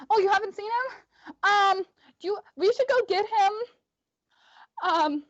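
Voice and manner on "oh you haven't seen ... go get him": put-on high little-girl voice; small child. Little girl urging them to get him, high child voice. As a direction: plead high, like a young girl. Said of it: "and she's like".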